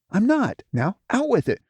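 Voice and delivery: high, loud pushy demand; high loud impatient command. Acted. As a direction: push her to speak. Smudge angrily pressing for message.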